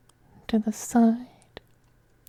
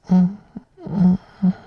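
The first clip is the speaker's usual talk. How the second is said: kissing sounds; 7 dB louder than usual. Acted. Vampire kissing his servant's lips, hummed kiss sounds. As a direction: savour the kiss with little hums.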